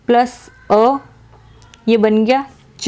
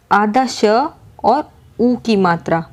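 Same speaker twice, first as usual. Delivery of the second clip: usual speaking voice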